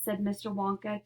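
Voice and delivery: ordinary delivery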